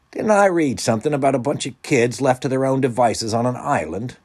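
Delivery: high, loud mock wondering; sarcastic musing. A narrator's reading. Wendell doubting the island plan, sarcastically musing. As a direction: muse aloud with pointed sarcasm. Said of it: "Wendell mused sarcastically".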